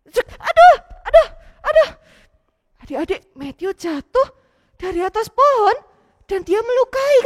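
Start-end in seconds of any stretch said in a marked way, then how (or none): 0.1-1.9 high, loud yelps of pain; falling cry. Acted. Matthew falling from the tree, yelping in pain. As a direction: cry out as the branch gives way.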